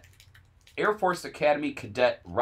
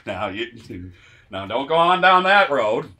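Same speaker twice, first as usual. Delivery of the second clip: put-on Southern belle accent; 7 dB louder than usual. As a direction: play a warning Southern belle. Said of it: "a Southern belle".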